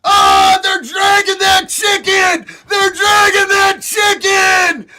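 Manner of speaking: loud, high, gloating cheers at the screen; gleeful shouting. Real, not acted. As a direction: yell it out in real delight. Cheering a video of arrest.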